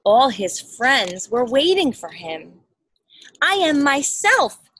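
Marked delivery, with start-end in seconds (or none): 3.4-4.7 joyful shout. Acted. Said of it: "he shouted, full of joy".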